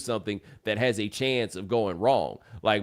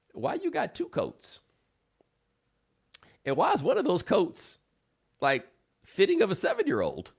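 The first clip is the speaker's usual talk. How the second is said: surprised; 8 semitones higher than usual. Retold or imitated. Realizing the kid is coming.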